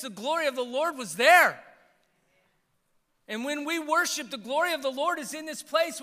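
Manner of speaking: high, loud impassioned preaching; preaching at full force. Real, not acted.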